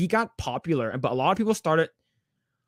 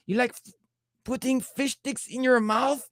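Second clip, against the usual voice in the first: Carlos Mencia; 9 semitones higher than usual. Acted. Comedian continues the joke setup, mock Mexican accent. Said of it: "his own Mexican accent".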